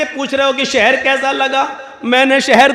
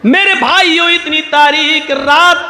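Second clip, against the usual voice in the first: preaching; 2 semitones higher than usual. Acted. Preacher tells his brothers about the dark grave, impassioned.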